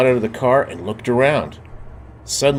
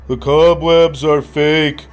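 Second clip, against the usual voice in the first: Marcel's voice; 6 semitones higher, 6 dB louder than usual. A narrator's reading. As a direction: dismiss the fear boldly. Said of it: "said Marcel".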